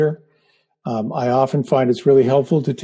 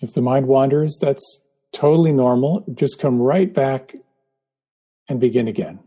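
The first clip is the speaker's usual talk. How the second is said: guided meditation. Acted. Leads a guided breathing meditation.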